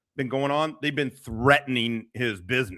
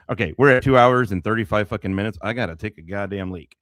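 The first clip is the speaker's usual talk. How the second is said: normal talking voice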